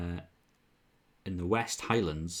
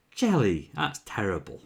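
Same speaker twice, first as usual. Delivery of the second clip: ordinary delivery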